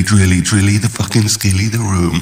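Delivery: ordinary talk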